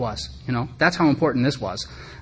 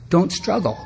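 higher, blunt two-word order; friend's blunt advice; 6 semitones higher, slower than usual. Retold or imitated. Friend Steve cuts off the rant, blunt and higher. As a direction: hand him the answer flat and final. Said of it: "Steve's like".

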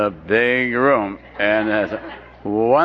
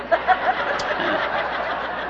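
soft laugh; 5 dB quieter than usual. Real, not acted. Laughing at own joke.

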